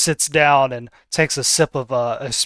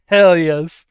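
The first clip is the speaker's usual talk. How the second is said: loud, thrilled cheer; pumped up by the music; excited; 5 semitones higher, 6 dB louder, much slower than usual. Real, not acted. Cheering jazz music choice.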